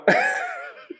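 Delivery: very high, thin mock giggle; squeaky high giggle. High squeaky laugh after plain line.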